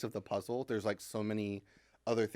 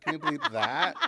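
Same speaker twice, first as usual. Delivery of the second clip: laughing plea; 5 semitones higher, 8 dB louder than usual. Real, not acted. Laughing request after gaffe.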